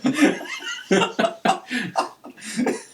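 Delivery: breathless, high-pitched laughing fit; cracking up at the punchline; helpless high giggle; high wheezing laugh. Real, not acted. Laughs at own story.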